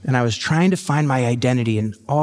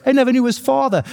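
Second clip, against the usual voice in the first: much higher, sad-cartoon-shark voice; Bruce the shark impression; 11 semitones higher than usual. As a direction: squeak it out like the shark from Finding Nemo. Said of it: "Like Bruce from Finding Nemo, the shark".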